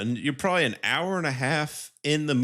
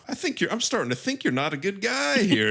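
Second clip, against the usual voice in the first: mock mad; 11 semitones higher than usual. Psychiatrist confronts the prisoner, mock mad. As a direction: act mildly mad in a much higher voice. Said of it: "he gets mad at Russell Crowe".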